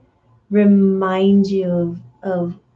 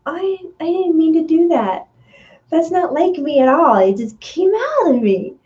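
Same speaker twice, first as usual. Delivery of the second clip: higher, flustered mock apology; apologetic; 8 semitones higher than usual. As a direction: play it as startled regret. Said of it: "and you're like".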